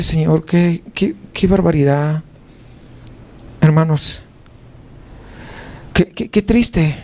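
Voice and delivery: low, halting dismay; sorrowful lament. Retold or imitated. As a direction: lament it heavily, stumbling over the repeated words. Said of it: "el corazón se comienza a llorar".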